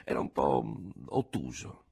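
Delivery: ordinary delivery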